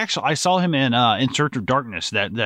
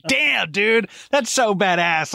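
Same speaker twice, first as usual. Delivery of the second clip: awed excitement; 9 semitones higher, slower than usual. Retold or imitated. Reacting to Schwarzenegger's post.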